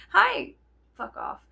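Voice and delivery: high bright hi, then a dropped fuck off; fake-sweet hello, then snap; sarcastic greeting. To the rude men: fake-sweet greeting turned dismissal. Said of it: "I'm like".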